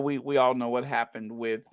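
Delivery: ordinary delivery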